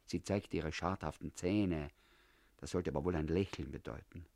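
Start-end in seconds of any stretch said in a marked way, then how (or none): none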